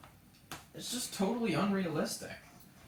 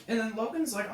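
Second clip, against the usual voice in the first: ordinary talk, nothing marked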